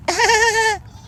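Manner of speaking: very high squealed goat cry. Pretend. Owner showing viewers Lulu's high squeal. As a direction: let out a tiny goat squeal. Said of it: "really high pitch".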